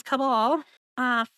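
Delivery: ordinary delivery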